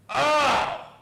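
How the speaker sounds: loud, high acted "oh"; startled outcry. Client crying out in her dream, loud startle.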